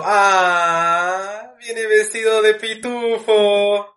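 high, loud and slow put-on voice; drawled mocking neighbor; mocking adult voice. People's remark on Enzo, acted.

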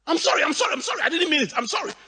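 mock begging. Kneeling father begging apology.

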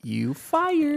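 high, drawn-out "you fired"; mock boss firing. Friend riffing on the driver losing cash, mock high firing.

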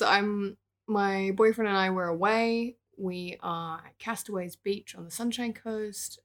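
ordinary talk, nothing marked

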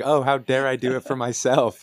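high, loud sarcastic whine; mocking whiny voice. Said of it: "You're just like".

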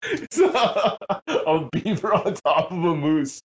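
slow, laughing through the words; describing it while laughing. Real, not acted. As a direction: talk while still laughing.

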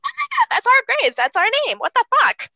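put-on high, fast, shocked child voice; high, panicked kid voice. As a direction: squeal in disbelief at the graves. Ghost kids discover graves.

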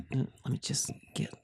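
breathy whisper into the mic. Real, not acted. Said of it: "It's 'cause I'm whispering".

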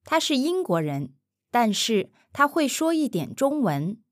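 他是英国人，但是他会说一点中文 at an easy, quick native pace; now say it the way a native would, fast; natural speed. Shown as a demonstration. Mandarin teacher repeating at natural speed for the learner. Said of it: "natural repeat after model".

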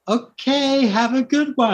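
high-pitched imitated clerk send-off; mock-polite high clerk voice; humouring store clerk. Clerk humours an odd customer.

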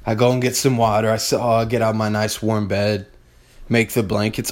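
ordinary talk, nothing marked

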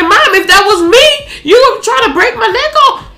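hammed-up indignation, climbing into a shout; mock outraged yelling. Acting out old anger at mama.

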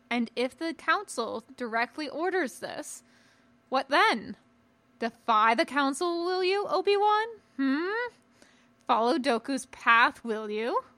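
put-on probing old master, pitch climbing on questions; Yoda, challenging. Yoda testing Obi-Wan's loyalty to the council, challenging. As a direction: press him with loud, rising questions.

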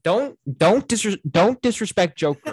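loud, halting, emphatic protest; playful indignant outburst; mock outrage. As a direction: jump loudly to a favourite's defence. Mock outrage defending a favourite film.

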